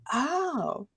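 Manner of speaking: high, soft dawning "oh"; retold realisation.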